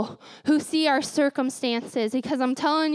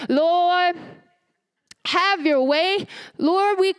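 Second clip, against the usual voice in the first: grandma crying out; 3 semitones higher, slower than usual. Retold or imitated. Grandma crying out in prayer, fervent. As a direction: cry out to the Lord like grandma. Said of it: "start crying out".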